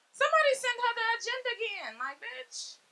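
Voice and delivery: put-on high, indignant party-girl scold; mock scolding. Comic scolds her friend at the birthday trip, high and indignant. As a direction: call her out for ruining the party.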